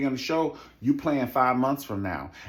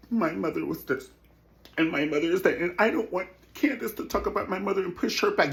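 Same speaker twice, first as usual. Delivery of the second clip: lightly mimicked whine, pitched up; mock complaint; 7 semitones higher than usual. Mimicking a castmate going on about her mother, mock complaint. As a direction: imitate her complaining, higher than your own voice.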